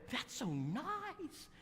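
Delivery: high, slow, sugary lady voice; Brenda's voice. Retold or imitated. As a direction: stretch it out like a sweet Southern lady. Preacher voices Brenda sweetly.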